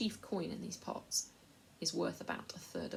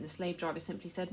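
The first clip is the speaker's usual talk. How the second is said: plain reading voice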